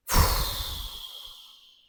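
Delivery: long unvoiced puff of exasperation; exasperated sigh. Real, not acted. Fed-up creator venting about messages, long exasperated puff. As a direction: blow out air, fed up.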